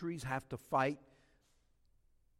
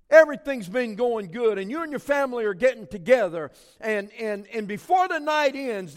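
loud, high, driving preacher's voice; full-force preaching; 8 semitones higher, 13 dB louder than usual. Acted. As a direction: preach it hard to the whole church.

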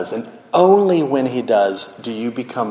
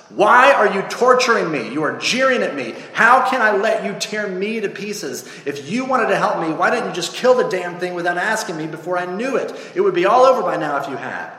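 high, aggrieved outburst; tormented ghost; 8 semitones higher than usual. Acted. Ghost accuses the angel of torturing him, high and aggrieved. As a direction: accuse in a raised, anguished pitch.

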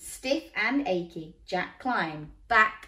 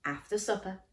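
ordinary delivery, nothing marked

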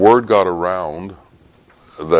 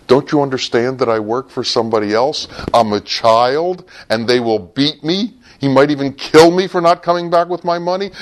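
put-on high, pleading boy's voice climbing into panic, faster at the end; frightened child pleading; 9 semitones higher than usual. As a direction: beg like a terrified child afraid of a beating. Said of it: "And the little boy goes".

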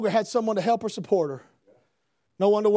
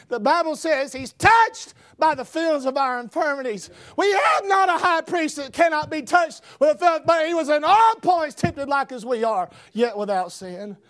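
higher, forceful pulpit delivery; full-force preaching; 6 semitones higher, 3 dB louder than usual. Real, not acted. Preacher declaring that He's touched by our infirmities, full-force preaching. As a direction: proclaim scripture loudly from the pulpit.

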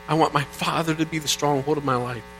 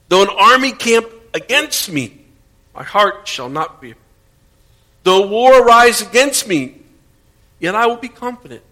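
higher and much louder, proclaiming each line; preaching at full force; 6 semitones higher, 7 dB louder than usual. Acted. Pastor proclaiming Psalm twenty-seven to the congregation, loud and fervent. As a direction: declare it boldly over the room, building.

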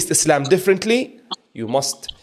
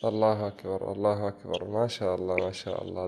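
soft, low praise under the breath; hushed, moved repetition of praise; awed murmur; 5 semitones lower, 12 dB quieter than usual. Real, not acted. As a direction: whisper your wonder to yourself. Host murmurs praise in awe.

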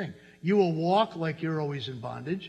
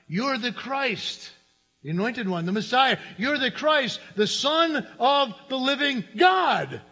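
triumphant confession; 8 semitones higher, 5 dB louder, slower than usual. Acted. Peter's climactic confession.

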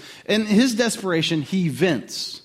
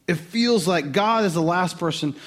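usual speaking voice

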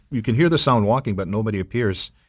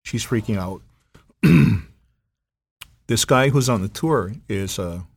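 ordinary delivery

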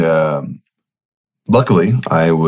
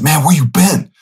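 much higher, delighted call; dad thrilled to hear from his son; dad excited; 9 semitones higher than usual. Retold or imitated. Dad in the dream greeting his son, high and excited. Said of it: "And he said".

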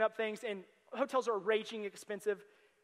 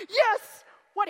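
cheer; 10 semitones higher, 11 dB louder than usual. Retold or imitated. Fans cheering at a home run.

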